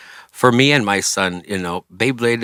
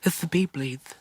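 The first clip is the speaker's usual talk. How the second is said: put-on excited little boy spotting a toy, lisping; imitating his little son; 8 semitones higher, 6 dB quieter than usual. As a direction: play the lisping toddler, a bit higher. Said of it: "And he had a lisp".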